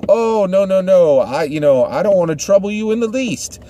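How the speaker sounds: high lady demurely declining coffee; church lady voice. Retold or imitated. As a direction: protest sweetly, too polite to accept. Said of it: "And the other lady's like".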